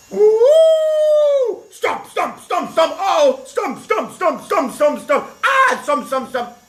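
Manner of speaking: high, frantic stop stop; panicked pleading. Acted. Policeman nearly trampled by an elephant, high frantic pleas. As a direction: squeal for it to stop.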